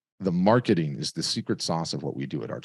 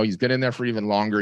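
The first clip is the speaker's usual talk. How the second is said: ordinary talk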